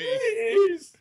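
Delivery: very high cracking please. Acted. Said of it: "I kinda put on that as a, for theatrical purposes".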